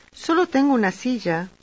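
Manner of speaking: ordinary delivery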